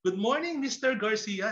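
acted chorus of eager pupils; eager class greeting. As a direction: greet the teacher like keen first-day students. Eager first-day class greets teacher.

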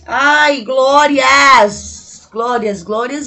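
loud, drawn-out cry of "glórias"; joyful cry. Real, not acted. Vlogger thrilled the foundation finally matches her skin, loud joyful cry. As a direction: let it burst out in delight.

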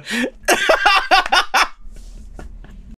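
squeaky breathless giggle; high wheezing laugh. Real, not acted. Streamer laughing at the wall joke, high wheeze. Cracking up.

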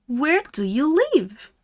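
usual speaking voice

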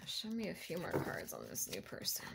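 very soft, hushed whisper; whispered request. Real, not acted. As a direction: whisper it privately, barely voiced.

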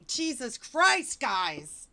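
high, slow, loud cry of disbelief; a pained shout at the ridiculous text; exasperated outburst. Real, not acted. Reacting to the book, a pained loud cry.

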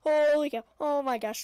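ordinary talk

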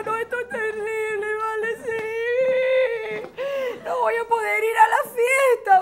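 high, drawn-out hysterical wailing; hammed-up tearful whine, swelling loud; teen meltdown. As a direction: sob melodramatically down the phone to a friend. Acts teenager's pimple crisis by phone.

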